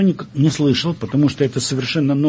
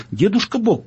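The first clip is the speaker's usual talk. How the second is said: quicker, higher-pitched plea; hasty little girl; 7 semitones higher than usual. A narrator's reading. Daughter steps in for her parents, hasty intervention. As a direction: cut in lightly as a daughter interceding. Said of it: "поскорее вмешалась девочка".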